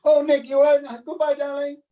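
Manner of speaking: high, loud, gushing goodbye; mock-grand society lady farewell; airy hostess. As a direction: toss off a breezy darling. Imitating April's airy goodbye.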